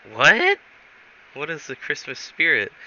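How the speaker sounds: shocked what. Retold or imitated. Shocked question.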